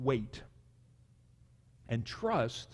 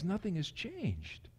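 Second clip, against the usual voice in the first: ordinary talk, nothing marked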